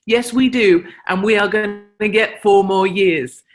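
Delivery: an imitated clerk cheering yes; retold eager agreement. Mohawk clerk agrees enthusiastically.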